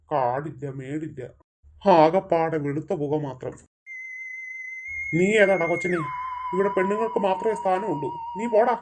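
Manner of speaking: low, menacing demon voice with an evil laugh. Acted. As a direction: loom over them like a forest spirit. Demon warns the boy away.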